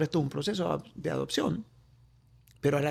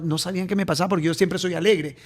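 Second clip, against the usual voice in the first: usual speaking voice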